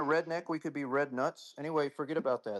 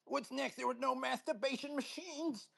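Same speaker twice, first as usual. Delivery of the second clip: high, mocking caricature voice; mock put-on voice; 9 semitones higher than usual. Mocking the guest he expects to come in, high put-on voice.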